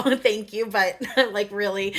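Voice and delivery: bursting into high laughter; giggling helplessly at the joke; laughing. Real, not acted. Giggling at Yeshua's joke, laughing hard.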